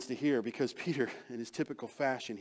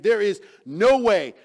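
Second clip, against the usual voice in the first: loud, slow, drawn-out refusal; emphatic no way; 13 dB louder, slower than usual. Acted. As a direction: refuse loudly, each phrase slow and firm. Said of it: "It's like he's saying".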